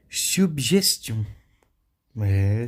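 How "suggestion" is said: "Suggestion" very slow and high, as süb-zhes-tyon; French pronunciation demo. Shown as a demonstration. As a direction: stretch "Suggestion" by syllable. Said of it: "esse som de G G".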